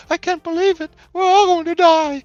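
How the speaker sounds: high, loud panicky wail; frightened townsfolk. Acted. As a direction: fret in a high put-on voice. Frightened townsfolk fear doom.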